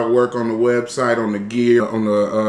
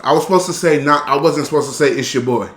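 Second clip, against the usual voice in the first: ordinary talk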